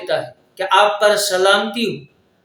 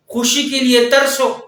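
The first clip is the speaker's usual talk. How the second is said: ordinary talk, nothing marked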